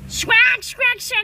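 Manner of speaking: loud, high parrot squawks. Pretend. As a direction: screech it like a parrot. Parrot toy squawking again.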